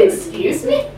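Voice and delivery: high, breathy incredulous "excuse me" with a laugh; shocked disbelief, half laughing. Retold or imitated. Passenger reacting to the taxi driver's comment, incredulous.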